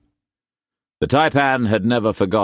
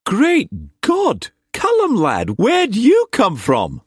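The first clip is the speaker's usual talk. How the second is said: loud astonishment, voice shooting up very high; astonished greeting; 4 dB louder, slower than usual. Acted. Uncle greets his nephew arriving unexpectedly, astonished. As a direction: burst out in high-pitched amazement. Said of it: "He got up excitedly".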